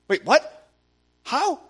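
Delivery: loud, high jump of surprise; stunned disbelief. Retold or imitated. Hearing the cousin found faith, loud stunned surprise. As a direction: squeak out the how in shock. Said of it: "And I was like".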